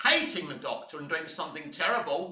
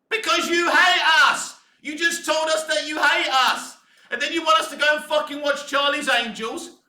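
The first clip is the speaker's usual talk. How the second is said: loud, higher accusing yell; angry shout; 7 semitones higher, 5 dB louder than usual. Real, not acted. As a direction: hurl it at the studio. Ranting at producers.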